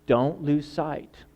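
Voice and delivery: ordinary talk, nothing marked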